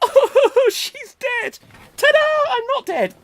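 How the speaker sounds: very high, loud squeal; squeaky high voice. Acted. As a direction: squeal it out, cartoon style. Acted Up blooper reel.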